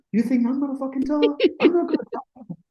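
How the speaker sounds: high, indignant retort, imitated; mock incredulous refusal. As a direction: refuse as if the idea were absurd. Colleague balks at criticising Whitney.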